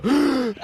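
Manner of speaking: sharp, mock-horrified gasp; imitated gasp for air. Storyteller imitates the rabbi's stunned wife, gasping. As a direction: suck in air like a shocked wife. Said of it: "just gasp for air".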